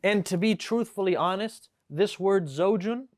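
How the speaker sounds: ordinary delivery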